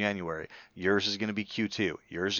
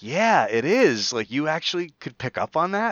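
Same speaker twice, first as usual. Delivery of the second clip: higher, louder astonished reply; retold amazement; 7 semitones higher, 6 dB louder than usual. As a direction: react as if pleasantly amazed. Retold amazed reaction to listeners.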